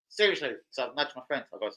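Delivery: ordinary talk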